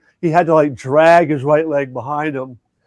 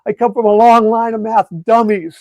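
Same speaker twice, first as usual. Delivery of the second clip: put-on higher kid voice, blurting it out; a lightly voiced discouraged boy, pitched up; imitating a student blurting out, higher; blurted-out kid; 5 semitones higher than usual. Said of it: "he kind of blurted out".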